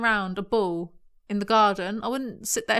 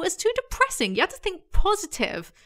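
mock preachy optimism; 10 semitones higher than usual. Mocking Ava's preachy optimism.

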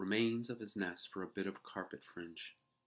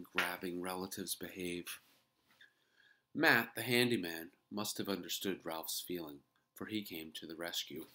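plain reading voice

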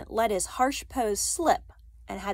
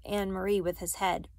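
ordinary delivery

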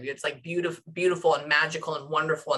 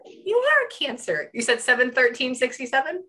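excited surprise; 7 semitones higher, 4 dB louder than usual. Real, not acted. Hearing client's birthday.

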